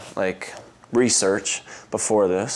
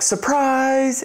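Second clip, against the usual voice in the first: much higher, sung-out surprise; family's surprise shout. Retold or imitated. Family greeting the couple at dinner, high cheer. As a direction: call it out like the whole family.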